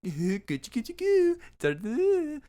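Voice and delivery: put-on high, slow, sing-song baby talk; baby-talk tickling coo. Tickling the robber, he coos in high baby talk.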